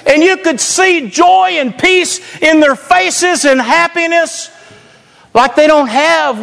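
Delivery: high, driving pulpit delivery; fired-up preaching. Real, not acted. Proud pastor tells congregation about his teenagers, excited preaching.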